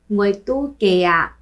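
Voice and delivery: ordinary talk, nothing marked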